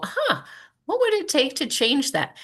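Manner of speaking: high, light musing question; acted curiosity. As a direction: pitch jumps up on a pondering huh. Acts out her question to cashiers.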